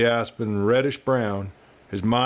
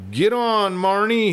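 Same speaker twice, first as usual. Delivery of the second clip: high, raised call to the burro; cried out; 10 semitones higher, 3 dB louder than usual. A narrator's reading. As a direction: urge the burro on with a cheerful cry. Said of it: "he cried".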